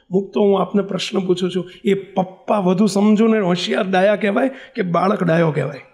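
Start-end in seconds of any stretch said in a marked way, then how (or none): none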